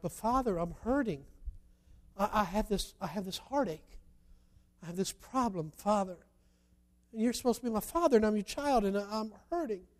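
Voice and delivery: softly acted plea; a touch of wounded child appealing; hurt pleading. Hurting child begs the Father, understated quiet pleading.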